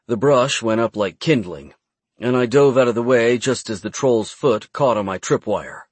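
ordinary delivery, nothing marked

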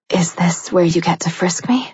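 breathless, playful. A narrator's reading. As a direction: tease him, a little out of breath. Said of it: "she asked breathlessly, playfully".

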